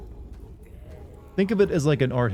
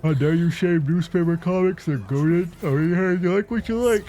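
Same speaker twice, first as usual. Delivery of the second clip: higher-pitched mock-offended defender; mock indignation; 7 semitones higher than usual. Streamer mimics an offended newspaper comics fan, mock indignation. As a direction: put on a higher voice, climbing as it goes.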